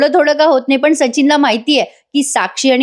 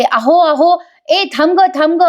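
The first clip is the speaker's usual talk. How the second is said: higher, raised call after someone running off; calling out loudly; 6 semitones higher, slower than usual. Retold or imitated. Sayali calls after the fleeing woman, a mock loud shout. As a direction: shout to stop her as she flees. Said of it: "Sayali जोरात ओरडते".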